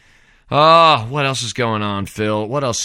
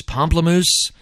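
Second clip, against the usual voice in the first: puzzled question; 8 semitones higher, 3 dB louder, slower than usual. Real, not acted. Repeats band name incredulously.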